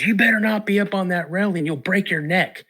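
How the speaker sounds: father snapping at his son on the railing, mock angry; dad scolding. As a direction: imitate an upset father warning his kid. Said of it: "He gets mad 'cause he goes".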